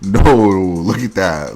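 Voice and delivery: reacting to a wide-eyed photo, loud and slow; incredulous. Real, not acted. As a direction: stretch it out in shock. Reacting to her wide eyes.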